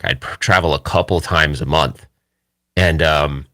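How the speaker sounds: ordinary talk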